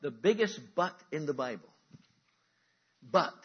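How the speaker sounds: "But" punched very loud, alone; capitalized but. Acted. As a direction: hit "But" hard, then pause. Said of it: "I've got it capitalized".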